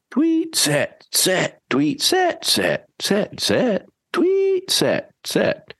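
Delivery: high sharp tweets between firm set commands; whistle and set commands. Shown as a demonstration. Trainer demonstrates stopping the dog with whistle and set. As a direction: demo the whistle and stern set calls. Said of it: "blowing my whistle and saying set".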